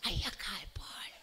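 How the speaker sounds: breathy secret whisper, slow; whispered girlfriend voice. Acted. Girlfriend whispering to her lover at night, slow whisper. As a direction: whisper like a girlfriend hiding under the sheet.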